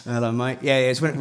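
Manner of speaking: ordinary talk, nothing marked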